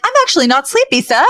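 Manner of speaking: high-pitched, acted eager butler; eager butler protest. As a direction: pipe up high, angling to stay. Acted butler angling to stay.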